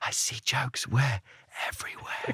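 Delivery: hushed spooky whisper; conspiratorial whisper. Acted. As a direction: whisper it like a secret reveal. Whispered mock-mysterious reveal.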